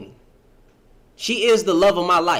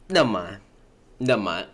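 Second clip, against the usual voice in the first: ordinary talk, nothing marked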